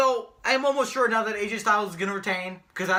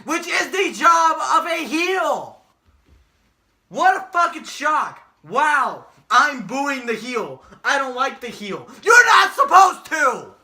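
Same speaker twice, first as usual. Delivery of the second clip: building rant; 6 dB louder, slower than usual. Real, not acted. Lecturing fans about heels, building angry shout. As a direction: hammer every line harder until you yell.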